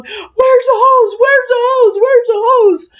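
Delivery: very high, loud panicked shriek; frantic yelling. Retold or imitated. Young man running to the operator, imitated frantic yelling. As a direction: yell it over and over in high-pitched mock panic. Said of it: "and he's yelling".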